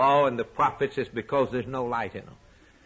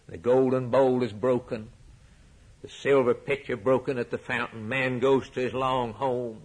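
ordinary talk

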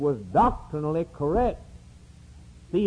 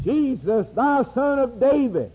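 higher, raised call; 8 semitones higher, 3 dB louder than usual. Retold or imitated. Blind beggar hailing Jesus from the roadside, calling out. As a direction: call out across the crowd.

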